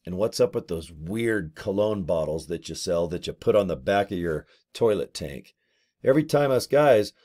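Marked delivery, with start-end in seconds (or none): none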